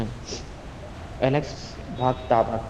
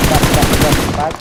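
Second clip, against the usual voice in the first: shouting; 2 semitones higher, 9 dB louder than usual. Real, not acted. Urgent callout in gunfight.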